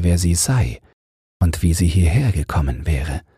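plain reading voice

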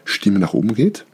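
"geht" lifted at the end like a question; questioning rise on "nach oben geht"; rising intonation. Shown as a demonstration. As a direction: let the pitch climb up to "geht". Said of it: "kannst du es so intonieren".

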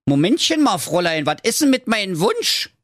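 high-pitched frog, protesting; insistent high-voiced frog; Frankie der Frosch. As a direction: demand it in a high frog character voice. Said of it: "Doch Frankie der Frosch fragte".

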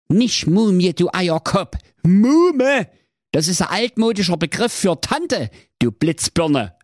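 hohe, laute keifende Hexe; keifend. Acted. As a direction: schimpf wie eine zänkische Alte. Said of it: "Die Alte keifte". (keifende Hexe)

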